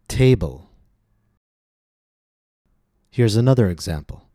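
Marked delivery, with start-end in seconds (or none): 0.1-0.7 "Table" stressed on its long first syllable. Shown as a demonstration. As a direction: lift the start of "Table" and let it fall. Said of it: "Notice the stress on the first syllable. Long vowel, higher pitch".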